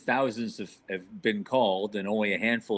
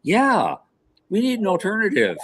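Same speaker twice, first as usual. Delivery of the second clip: sudden realization; 8 semitones higher, 5 dB louder than usual. Acted. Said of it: "nasa wakes up and said".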